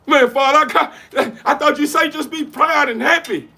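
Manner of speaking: high, loud, eager protest; excited son. Acted. Son protesting to his father, high and loud with excitement. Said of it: "You're too excited".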